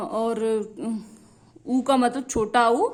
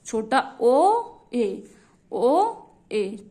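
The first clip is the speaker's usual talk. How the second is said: slow stretched; much slower than usual. Shown as a demonstration. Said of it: "ओए का मतलब क्या हो गया".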